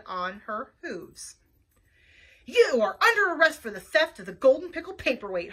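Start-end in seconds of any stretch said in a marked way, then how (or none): 2.5-5.5 loud, commanding holler; hollered arrest. A narrator's reading. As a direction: holler it like a police sergeant. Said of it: "hollered Sergeant Sniff".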